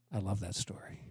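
hushed, breathy whisper; soft whispered love for the story; whispered aside. Real, not acted. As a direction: drop to a whisper of delight.

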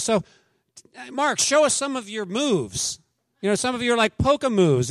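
high, bright mock-eager asking; wife eagerly asking for a show; eager request. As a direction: coax him like an excited hostess. Pastor's wife eagerly asks at dinner.

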